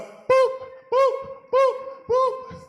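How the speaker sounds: very high, loud cartoon pops; popping boop. Tails popping off one by one, very high boops. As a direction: squeak out each pop.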